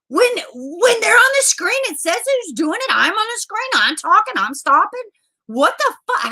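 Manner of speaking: high-pitched, outraged rant; pissed off. Real, not acted. Streamer ranting about a copyright strike, pissed off. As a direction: rail at them in indignant disbelief. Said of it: "that pisses me off".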